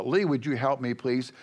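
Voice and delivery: normal talking voice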